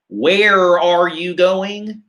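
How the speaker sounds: "Where are you going?" with every R hard and rolled out, slow; imitating a hard-R American-style English; mock American accent, the R in "Where" and "are" fully sounded; rhotic English. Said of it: "they use those hard R's".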